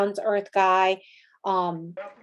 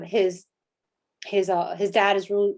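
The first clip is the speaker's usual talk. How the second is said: ordinary talk, nothing marked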